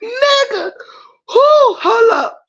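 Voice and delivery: high, cracked laugh-crying; laughing to tears. Real, not acted. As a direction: gasp it out through tears of laughter. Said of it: "I'm crying in real life".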